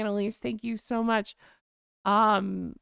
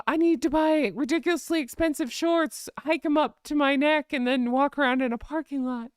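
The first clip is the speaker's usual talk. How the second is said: pitched-up mock-feminine voice; faux feminine; 3 semitones higher than usual. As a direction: mimic her with a put-on girlish lilt. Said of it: "I just put on this faux feminine".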